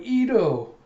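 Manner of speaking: high, loud cheerful call. Retold or imitated. Shamir runs up calling to Ito, loud and high. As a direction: call his name across the bar.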